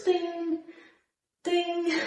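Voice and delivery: high soft sing-song ding ding; toy bird ding. Retold or imitated. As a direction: chime it like a little toy bird. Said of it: "remember those little toy birds".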